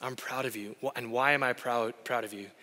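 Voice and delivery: quiet, earnest dad voice; gentle fatherly affirmation. Retold or imitated. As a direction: speak it close, looking him in the eyes. Dad affirming son on couch.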